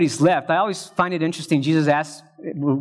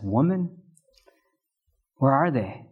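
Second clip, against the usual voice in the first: gentle and loving; 2 semitones lower than usual. Retold or imitated. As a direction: address her gently, lovingly, unhurried. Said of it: "he very gently and lovingly says".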